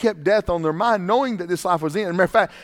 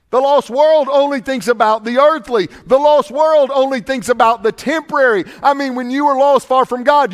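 higher, louder preaching cadence; 6 semitones higher, 7 dB louder than usual. Acted. Preacher warning lost visitors, preaching at full force. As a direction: drive each line harder.

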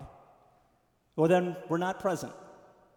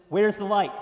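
emphatic question; 3 semitones higher, 8 dB louder than usual. Real, not acted. Rhetorical punch line in sermon.

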